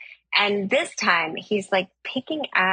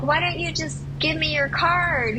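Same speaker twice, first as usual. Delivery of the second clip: high-pitched call across lanes; calling back; 9 semitones higher than usual. Retold or imitated. Driver asks pushy stranger for a card, calling back. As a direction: imitate shouting over, pitched up to carry.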